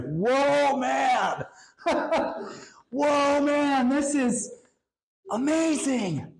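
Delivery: Adam can't believe what he sees; amazed laughing Adam. Acted. Adam waking to Eve, breathless laughing wonder.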